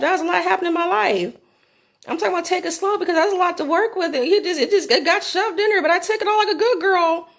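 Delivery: high, fast, laughing retelling; excited laughing. Real, not acted. Telling her audience about the night, laughing excitedly. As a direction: gush through it half laughing.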